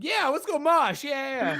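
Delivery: high, stretched-out eager cheer; mock hyped-up. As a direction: imitate an eager mosher. Acting out hyped concertgoers.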